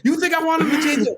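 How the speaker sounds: very high, indignant yell; retold heated comeback at the top of the voice; screaming argument. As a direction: shout it back, outraged. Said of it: "We're screaming at each other".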